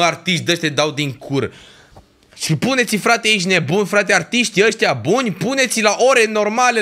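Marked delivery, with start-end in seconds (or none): 2.3-6.8 higher, heated insisting; angry demand. Real, not acted. Streamer demanding better set times from organisers, heated. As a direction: demand it like it's obvious. Rant about festival scheduling.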